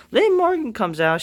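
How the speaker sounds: usual speaking voice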